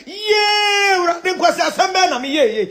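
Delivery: high, drawn-out cry of regret; hammed-up high-pitched lamenting; mock wail. Acts out someone's future regretful wail.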